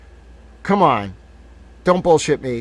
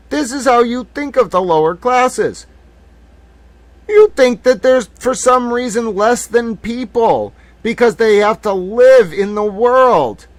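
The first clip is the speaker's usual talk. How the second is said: louder, higher, slower indignant tirade; raised voice condemning the rich; angry rant; 9 semitones higher, 5 dB louder than usual. Real, not acted. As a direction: lash out at the aristocrats, slow and loud. Ranting against aristocrats' class judgment.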